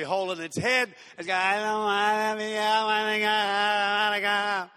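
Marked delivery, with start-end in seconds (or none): 1.4-4.7 put-on whiny child. Preacher acts the toddler whining in the supermarket, sing-song. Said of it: "this whiny thing is in your kid".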